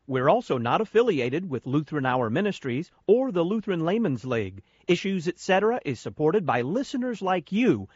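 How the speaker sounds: radio announcer appeal; announcer read. Acted. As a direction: pitch it like an on-air fundraising spot. Host reads sponsor appeal.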